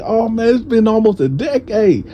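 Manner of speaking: high-pitched, amazed reunion voice; excited reunion greeting. Retold or imitated. Acts friend's joyful reunion greeting.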